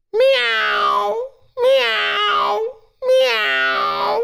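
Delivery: very high, thin meows; cat imitation. Brother hides up the tree from his sister, cat imitation. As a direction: mew like a cat, high and drawn-out. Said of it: "Her brother up in that tree goes".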